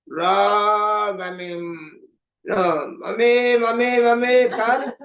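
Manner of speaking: high, drawn-out mock wail for mother-in-law. Daughter-in-law putting on a show of grief, mock wailing. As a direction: wail theatrically, stretching each cry. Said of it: "it's just drama".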